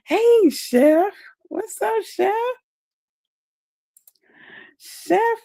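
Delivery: squealed hey to a friend; light up and call out high to a friend joining; excited high greeting. Real, not acted. Greeting Chef Finest Wine as he joins the live, excited and high.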